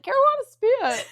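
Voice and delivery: high, loud mock outcry; retold high-pitched exclamation; teammates exclaiming. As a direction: call it out like shocked teammates. Teammates calling out what happened.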